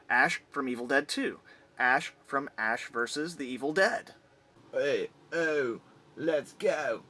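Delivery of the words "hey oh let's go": put-on British-accented punk rock chant; badly mixed-up British punk impression. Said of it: "doing [name] with a British accent".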